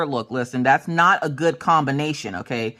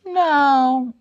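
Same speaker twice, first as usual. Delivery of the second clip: put-on high, drawn kid "no"; high-pitched little-kid voice; son's refusal; 10 semitones higher, 3 dB louder than usual. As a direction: imitate a child refusing. Voices son refusing food offers.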